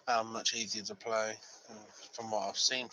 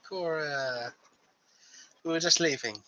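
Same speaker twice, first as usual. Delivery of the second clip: bright-faced greeting, lifting louder at the end; grinning greeting; 4 semitones higher, 5 dB louder than usual. Acted. Brother greets sister while just leaving, grinning. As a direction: greet her through a big grin. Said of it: "big grin on his face".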